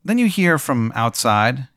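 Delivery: normal talking voice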